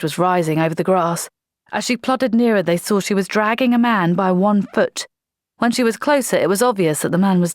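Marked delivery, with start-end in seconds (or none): none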